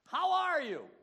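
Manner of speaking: hammed-up, high, very loud greeting; booming greeting. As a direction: call it out big and drawn out. Acts greeting someone in a crisis.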